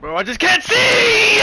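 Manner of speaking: high, slow frustrated shout. Real, not acted. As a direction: yell it out in frustration. Frustrated in game.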